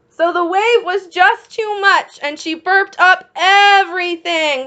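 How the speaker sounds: lifted, eager read; excited storytelling. A narrator's reading. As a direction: keep the kids thrilled. Story climax for children.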